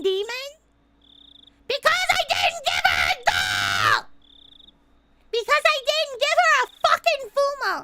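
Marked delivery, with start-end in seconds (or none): 1.7-7.8 loud, worked-up outraged shouting; ranting yell. Retold or imitated. Streamer reliving fury at the last chapter, ranting yell. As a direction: yell it out like an old grievance. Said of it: "I was just yelling".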